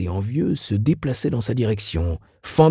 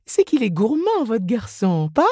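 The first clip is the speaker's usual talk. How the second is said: very high put-on woman's voice; chatty saleswoman; 13 semitones higher than usual. A narrator's reading. Miss Inez greets Mrs Reilly at counter, chatty. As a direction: greet a regular in a high squeal.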